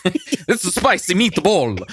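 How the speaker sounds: playful cartoon Italian catchphrase; jokey Italian accent, laughing; mock Italian accent. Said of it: "I sounded Italian".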